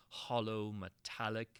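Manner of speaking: plain reading voice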